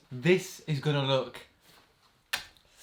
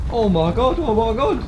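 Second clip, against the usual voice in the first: excited; 7 semitones higher, 6 dB louder than usual. Real, not acted. Box vibrating, rising excitement.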